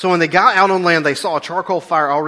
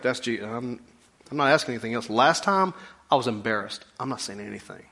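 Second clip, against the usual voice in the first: hushed Thomas; 5 semitones lower, 8 dB quieter than usual. Retold or imitated.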